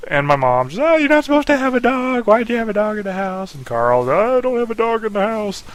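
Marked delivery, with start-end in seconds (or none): 0.8-3.5 much higher, louder, shrill-pitched motherly scolding; scolding mom. Retold or imitated. Mom scolding son about the dog, high upset voice. 4.1-5.6 much higher, insistent whiny denial; defiant brother denial. Retold or imitated. Carl denying the dog to mom, high insistent whine.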